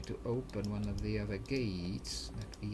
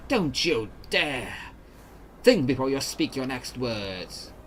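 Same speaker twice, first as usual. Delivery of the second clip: menacing threat; 5 dB louder than usual. Acted. Entity threatens the party.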